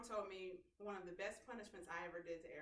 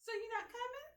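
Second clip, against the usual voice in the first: put-on little boy; 13 semitones higher than usual. Son voiced in story.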